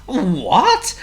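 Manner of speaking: high, swooping exclamation; startled waking. A narrator's reading. Sleeping owner woken by the crash, startled cry. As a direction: rise sharply as if jolted awake.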